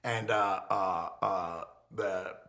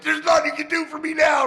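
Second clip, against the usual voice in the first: high, loud retold shout; ballistic outburst; 8 dB louder than usual. Defendant to probation guy beside the DA, ballistic shout. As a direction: yell it, reliving the courtroom blowup. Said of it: "I'm going ballistic".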